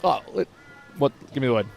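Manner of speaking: ordinary talk, nothing marked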